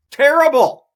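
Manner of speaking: high, loud exasperated cry of Terrible; shouted. Real, not acted. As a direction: yell Terrible. at the app. Ranting at the United app.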